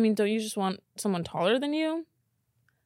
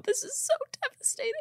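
much higher, softer, whiny; devastated; 7 dB quieter than usual. Real, not acted. Upset over a ditched date, high whiny dismay. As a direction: squeak it out in dismay. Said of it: "so upset right now".

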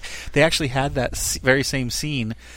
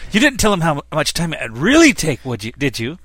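louder and higher, a teasing Scottish brogue; Scotty's Scottish accent; 5 semitones higher, 6 dB louder than usual. Retold or imitated. Scotty teasing Geordi about his estimates, in a Scottish accent. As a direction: needle the young engineer in a Scottish accent. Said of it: "you want me to do the accent?".

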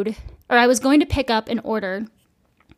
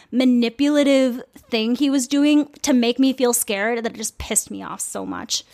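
usual speaking voice

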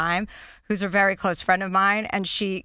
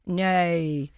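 tentative Australian "nay"; 3 semitones lower than usual. Shown as a demonstration. Host showing what she couldn't get, Australian accent. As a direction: attempt a drawn-out Australian "nay". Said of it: "trying to get that Australian nay".

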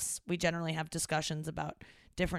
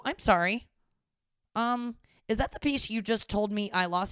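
pointed question; 4 semitones higher, 5 dB louder than usual. Retold or imitated. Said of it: "And I go".

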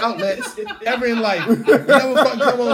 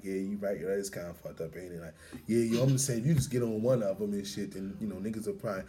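low muttered reply; 14 dB quieter than usual. Retold or imitated. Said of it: "I'm like".

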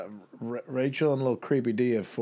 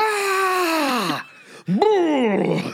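sudden high, loud yell; mock shout; 6 dB louder than usual. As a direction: blurt out a silly scare yell. Mock yelling at a stranger.

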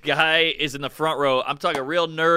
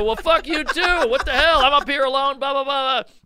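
mock angry rant; 11 semitones higher, 5 dB louder than usual. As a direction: rant back at the heckler, half laughing. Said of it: "So I go".

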